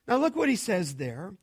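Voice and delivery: ordinary talk, nothing marked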